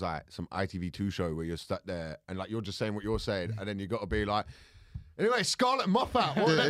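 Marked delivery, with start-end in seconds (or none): 5.2-6.7 high, laughing presenter parody; mock TV presenter. Comedian stuck presenting an ITV2 show, mock presenter. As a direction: put on a much higher chirpy host voice.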